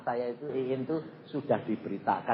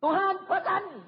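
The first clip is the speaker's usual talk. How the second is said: high, loud call to God; preacher retelling his demand to God, high; pleading prayer imitation; 4 dB louder than usual. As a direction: push it at God, read this. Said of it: "saya begitu niru".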